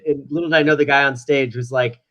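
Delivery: ordinary talk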